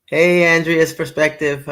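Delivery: ordinary talk, nothing marked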